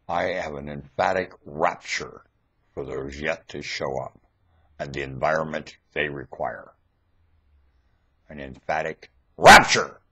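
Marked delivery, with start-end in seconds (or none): 0.1-4.2 hushed dreamy murmur; soft murmur. Real, not acted. Murmured then repeated loud. 8.3-10.0 much louder, punching rapture; loud insistent repeat; loud emphasis. Real, not acted.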